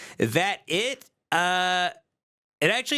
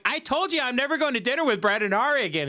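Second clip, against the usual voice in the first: fast, higher-pitched protest; imitated exasperation; 6 semitones higher, much faster than usual. Husband arguing with his wife about dinner, exasperated. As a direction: rattle off an exasperated objection. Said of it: "I'm like".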